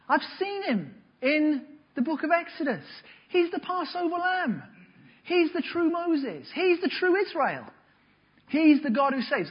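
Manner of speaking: high, amazed voice of someone recognizing Jesus; a bright gasp of recognition, pitched high. Pretend. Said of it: "everyone is supposed to go".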